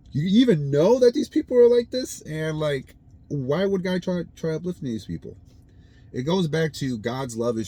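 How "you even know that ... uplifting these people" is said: much higher and louder, in laughing disbelief; can't believe what you just read; incredulous. Real, not acted. Reacting to Aaron's words in high, laughing disbelief.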